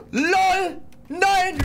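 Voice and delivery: sudden high shriek of shock; startled scream. Real, not acted. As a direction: jump out of your skin and yell no. Startled by a game monster.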